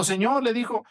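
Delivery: high pleading cry to the Lord. A narrator's reading. Woman at the border begging Jesus, crying out high. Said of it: "le iba gritando".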